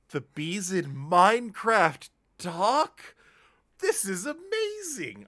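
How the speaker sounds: high, slow amazed wonder; overjoyed, disbelieving delight; excited. Retold or imitated. Retold delight at talking bee.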